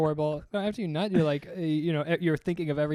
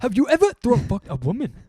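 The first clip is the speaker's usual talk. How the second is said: higher, louder mock African accent; attempted African accent; 8 semitones higher, 6 dB louder than usual. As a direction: put on a hammed-up African accent.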